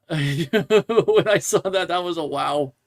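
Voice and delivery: slow, laughing through the words. Real, not acted. Host laughs at a silly fan post, laughing. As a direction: chuckle your way through it.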